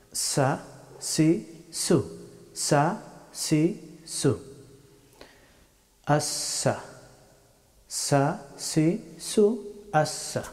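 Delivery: "সা, সি, সু" with a clean hissed s; tongue tip to the teeth for each "সা, সি, সু"; Arabic letter sin. Shown as a demonstration. Teacher drilling the letter sin for learners, crisp s syllables. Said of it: "সিন হরফটি উচ্চারিত হয়".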